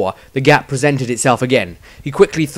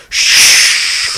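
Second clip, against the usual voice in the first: sharp whispered shush; urgent group shush; hushing; 8 dB louder than usual. A narrator's reading. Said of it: "came the reply from everyone else".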